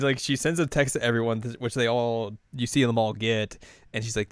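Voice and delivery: ordinary delivery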